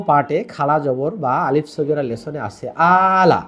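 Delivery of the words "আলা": ala with a long first a; drawn-out Arabic ala. Shown as a demonstration. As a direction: stretch the a of আলা. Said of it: "এই আলা শব্দটা আমি যদি বলি".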